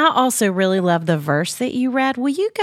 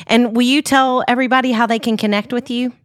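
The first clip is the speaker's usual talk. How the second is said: ordinary talk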